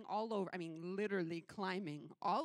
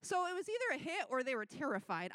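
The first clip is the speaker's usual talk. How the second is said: usual speaking voice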